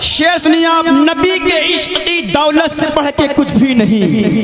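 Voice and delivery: high, ringing couplet recitation; fervent poetry at slogan pitch; declaimed devotional verse. Real, not acted. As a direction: cry the verse out to the crowd.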